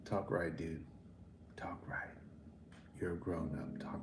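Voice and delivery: whispered, low scolding; hushed, exasperated scolding. Retold or imitated. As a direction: quietly tell the dude to talk right. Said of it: "I'm like".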